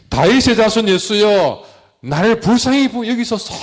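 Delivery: high-pitched, pleading call; imitated crying out. Preacher imitating the blind beggar calling to Jesus, crying out. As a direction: cry out as the blind man calling to be heard. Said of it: "제가 흉내는 못 내겠지만".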